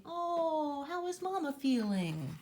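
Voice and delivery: plain reading voice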